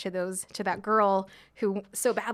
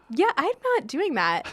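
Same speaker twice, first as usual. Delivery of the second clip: playful refusal; 8 semitones higher, 5 dB louder than usual. Retold or imitated. Refusing the mentor.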